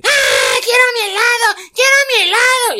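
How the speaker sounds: put-on high, loud bratty child wail; imitating a crying boy demanding his ice cream; whiny crying child. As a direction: wail like a spoiled kid wanting ice cream. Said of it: "Y el niñito, güey, llorando".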